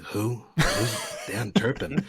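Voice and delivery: slow, clueless villain's question; puzzled. Retold or imitated. Villain answering a hero about a forgotten victim, mock puzzled. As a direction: imitate a villain drawing out his puzzlement. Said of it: "he has no idea".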